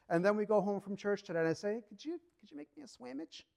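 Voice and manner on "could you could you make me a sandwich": mock innocent, high and much softer ask; sheepish request. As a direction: play the guilty husband sweetly asking a favour. Husband asks wife after sinning.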